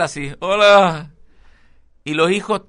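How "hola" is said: loud, high put-on greeting; tipo infiltrado. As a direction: act the over-age guy greeting teenagers. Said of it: "así como con Moicano tipo Vidal". (saludo impostado, agudo y fuerte)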